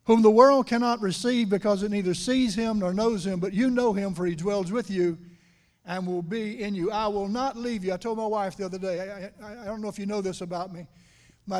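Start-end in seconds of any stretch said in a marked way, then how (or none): 0.0-7.9 loud, ringing preacher's reading of scripture; scripture proclaimed. Acted. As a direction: proclaim the verse to the whole church. Reads scripture at full force.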